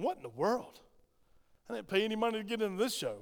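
mock puzzled visitor.

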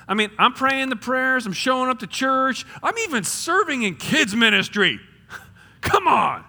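high-pitched, exasperated protest; mad at God. Acted. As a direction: complain loudly and indignantly at heaven. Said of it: "gotten a little mad at God".

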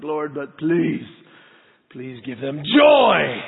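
slow, swelling mock-solemn plea; teasing drawn-out prayer. Dad teasing his squirming boys at bedtime, mock-solemn drawn-out plea. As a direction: stretch out please and make joy boom. Father drags out prayer before tickling.

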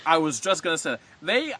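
ordinary delivery

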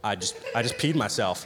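low, hushed realization; retold dawning horror. Student realizing what the old man thinks, low and hushed. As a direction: sink into a quiet, low disbelief.

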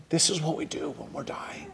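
hushed whisper to the congregation; a soft unvoiced confession. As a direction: lean in and whisper it. Intimate aside to the congregation.

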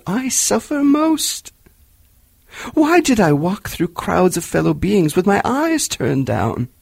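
a tormented spirit crying out; unearthly voice. A narrator's reading. Marley's ghost speaks.

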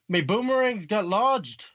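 put-on high, hammy Irish accent. Friend mocks Boomerang's line in an Irish accent. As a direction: do Boomerang in a cartoon Irish brogue. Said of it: "that sounded like fucking Irish".

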